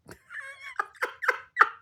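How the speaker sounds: squeaky high giggle; high laugh. Real, not acted. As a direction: burst out giggling.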